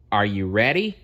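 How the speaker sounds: ordinary delivery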